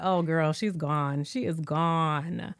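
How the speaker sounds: low, slow, drawn-out verdict; mock resignation. Host watching Mora fall for Rob, mock resigned. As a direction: give her up as a lost cause, softly.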